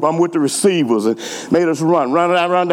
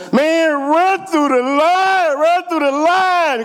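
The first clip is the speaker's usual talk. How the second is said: much higher, slow, drawn-out holler; coach yelling; 12 semitones higher, slower than usual. Retold or imitated. Coach hollering at the team to run through the line. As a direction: hammer the order home.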